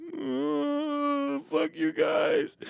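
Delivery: high, drawn-out whine; mock pout, stretched slowly; sulky kid. Left-out kid to gloating cousins, whining sulkily.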